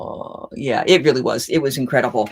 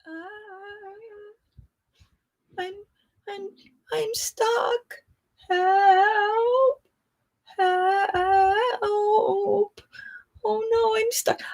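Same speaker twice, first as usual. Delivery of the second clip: high, whimpering cry for help; near tears; 4 dB quieter, much slower than usual. Retold or imitated. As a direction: whine like a child stuck on a bench. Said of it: "I'm almost on the verge of tears".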